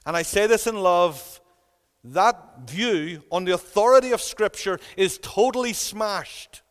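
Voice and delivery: impassioned, emphatic sermon delivery; insistent preacher rebutting an opposing view; forceful preaching. Real, not acted. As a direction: drive the argument home from the pulpit. Preaches at full force against a view.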